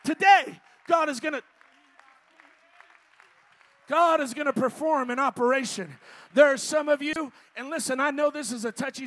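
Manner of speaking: loud, declaring preacher voice; preacher promising healing to the congregation, full-force preaching. Real, not acted. Proclaim it to the whole church.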